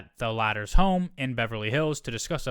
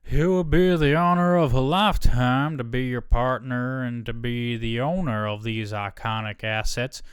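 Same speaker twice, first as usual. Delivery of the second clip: a Texas drawl, the first phrase louder; a CEO's Texan drawl, slowing on the second phrase; David Ellison Texas drawl; 4 dB louder than usual. Retold or imitated. Texan drawl for the CEO's text to the rival chief. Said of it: "so I'm just gonna say he's from Texas".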